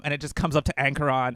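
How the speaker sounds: ordinary talk, nothing marked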